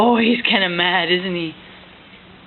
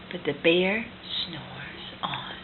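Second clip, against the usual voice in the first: hushed whisper for the sleeping bear; 7 semitones lower, 10 dB quieter, slower than usual. A narrator's reading. Parent reading to toddler, whispering about the sleeping bear. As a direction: whisper so the bear stays asleep.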